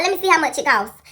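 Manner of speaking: loud, high mom mimicry; challenging mother. Mom checks the price, loudly scolding. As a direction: imitate a mother calling the bluff.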